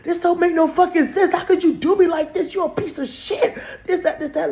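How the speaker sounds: high, loud crying rant; shrill tearful tirade; going off vocally. Retold or imitated. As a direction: wail it out, voice up high and half laughing. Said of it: "going off on him vocally".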